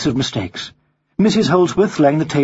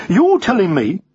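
sceptical policeman, raised pitch at first; edge to the voice; 8 semitones higher than usual. A narrator's reading. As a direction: question him with a sharp edge. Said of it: "there was an edge to his voice".

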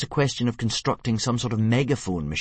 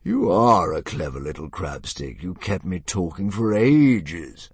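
slow, grand dragon; a lazy monster toying with prey; 6 dB louder than usual. Acted. Narrator voices the dragon.

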